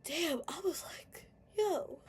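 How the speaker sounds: high, breathless, tearful; overwhelmed with joy; tearful awe. Real, not acted. Realizing she has her dad, tearfully.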